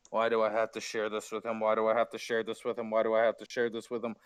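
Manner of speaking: low, fast, grumbling complaint; sulky Harpy voice. Acted. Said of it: "sit down and just go".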